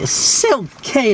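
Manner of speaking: put-on high sing-song girl voice; high girly voice. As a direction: squeak the name like a girl. Acting out the girl naming him.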